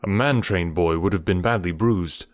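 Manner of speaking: plain reading voice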